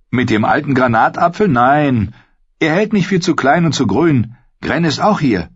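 deutlich höhere Jungenstimme; aufgeweckter Junge. A narrator's reading. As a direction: antworte lebhaft in hoher Jungenstimme. Pip antwortet, Gren meldet sich. (aufgeweckte, hohe Jungenstimme)

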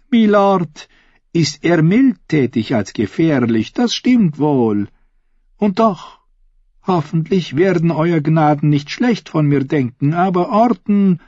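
high-pitched lady's voice, playful; Taena, confiding lady. Acted. Taena gossiping to the queen about her husband, high confiding voice.

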